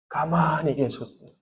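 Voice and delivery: dropped to a soft, hushed fall. Acted. Preacher telling youth of Jesus' silence, hushed. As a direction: let the words sink quietly.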